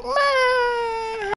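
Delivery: high, crying whine; high fake sob; mock crying. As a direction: whimper it like a hammed-up crybaby.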